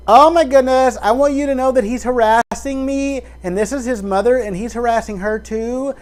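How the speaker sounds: high, loud frantic complaint; mock upset churchgoer. Imitating a woman reporting harassment, high and urgent.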